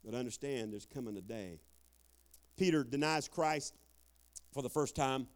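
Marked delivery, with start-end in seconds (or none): 0.0-1.6 low, soft, knowing warning; God quietly warning a wayward son, low and soft. Retold or imitated. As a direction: speak as God, calm and low. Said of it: "He's like".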